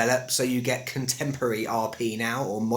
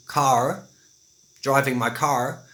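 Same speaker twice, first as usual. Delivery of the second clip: slow American accent with pronounced R; drawn-out rhotic American pronunciation; slower than usual. Shown as a demonstration. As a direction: put on an American R in "car". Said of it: "where an American would say".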